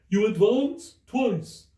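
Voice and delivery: high, loud, mournful Mock Turtle. A narrator's reading. The Mock Turtle quizzes Alice, high and mournful. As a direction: stretch it out like a weepy old turtle.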